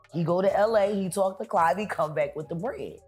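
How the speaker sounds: ordinary talk, nothing marked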